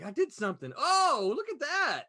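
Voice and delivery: mock-amazed discovery; hammed-up surprise; surprised. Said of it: "I was like".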